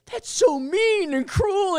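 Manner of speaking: a prissy scold; mock girlfriend. Host mimicking his girlfriend scolding him in a high whine. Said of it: "my girlfriend's like".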